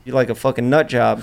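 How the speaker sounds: ordinary delivery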